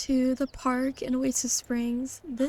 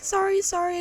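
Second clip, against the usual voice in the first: apologetic; 6 semitones higher than usual. Acted. Character apologizes for keeping friend waiting.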